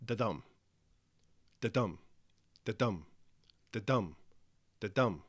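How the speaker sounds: "Da-dum" as a light "Da" then a stressed "dum"; evenly spaced "da-dum" beats, a pause after each; heartbeat rhythm. Shown as a demonstration. Teacher imitates a beating heart, "da-dum" stressed on "dum". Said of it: "the sound that a heart makes as it beats".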